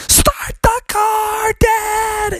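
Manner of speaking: very high, drawn-out shriek; panicked shout. Acted. Froggy begs dad to flee, panicked shout. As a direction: scream it in acted panic. Froggy screams as bear attacks.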